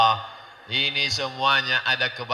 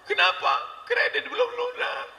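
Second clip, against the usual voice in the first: mock crying daughter; 3 dB quieter than usual. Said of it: "anak pulang nangis".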